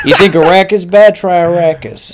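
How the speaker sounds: hammed-up loud quip; mock loud retort. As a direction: bark it out like a comeback. Joking retort about the book name.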